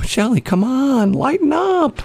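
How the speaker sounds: high, slow whiny plea; mock pleading. Host replaying how he begged his co-host, high whine. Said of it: "And I go".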